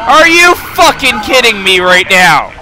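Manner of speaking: real rage at losing; furious rant. Real, not acted. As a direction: tear into the game. Raging at game defeat.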